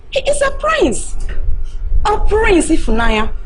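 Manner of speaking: high, loud exasperation; exasperated scolding. Acted. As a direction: stress that he's a prince.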